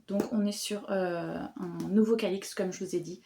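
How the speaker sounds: ordinary delivery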